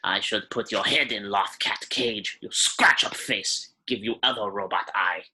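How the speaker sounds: put-on cranky old alien lady in broken Basic; old shopkeeper voice, grumbling; old Rhodian shopkeeper. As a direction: threaten then warm up, in character. Shopkeeper character voice.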